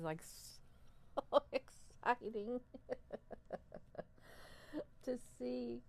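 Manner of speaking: high, slow, giggly delight; gushing excitement. Real, not acted. Showing viewers her worms, thrilled and giggling. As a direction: squeal over the worms with real joy.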